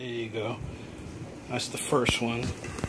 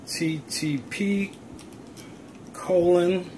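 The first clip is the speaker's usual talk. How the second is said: slow dictation; 3 semitones higher, 5 dB quieter, much slower than usual. Real, not acted. Dictating a web address.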